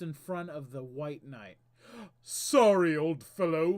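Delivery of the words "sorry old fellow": loud, apologetic henchman; a brash knave with an axe. Acted. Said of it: "the Knave says".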